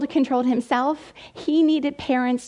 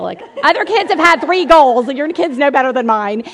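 dismissive onlookers; 3 semitones higher, 8 dB louder than usual. Retold or imitated. Other parents scoffing at the sobbing mom, mock indignant. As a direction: pitch it up and push it, mimicking scoffing onlookers. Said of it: "people were looking at me like".

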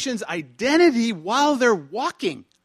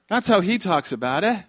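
ordinary delivery

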